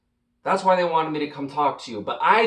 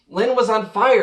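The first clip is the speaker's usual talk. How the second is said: excited fan; 6 semitones higher, 6 dB louder than usual. Acted. Fan raving about his idol.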